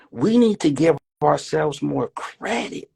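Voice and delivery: "need" drawn out slowly, every word weighted; slow emphasis. Real, not acted. Preacher to the crowd, slow stressed repeat. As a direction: lean on "need to give ourselves". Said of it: "What I'm trying to put emphasis".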